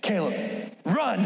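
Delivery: mock cowboy-movie line, terse with a pause; Wild West showdown. Brother warning his sibling in the tunnels, mock Wild West gravity. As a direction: deliver two words like a Western hero. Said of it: "like, a Wild West movie".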